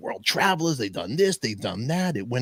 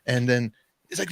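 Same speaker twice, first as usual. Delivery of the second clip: usual speaking voice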